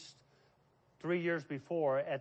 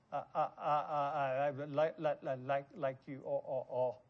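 stuttering demo; 4 semitones lower, 3 dB quieter than usual. Shown as a demonstration. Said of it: "a problem stuttering".

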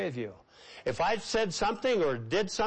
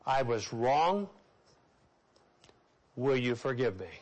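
low, slow and earnest, each word weighed; humble apology, slow and low; slow sincere confession; 5 semitones lower than usual. Shown as a demonstration. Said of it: "Seven words".

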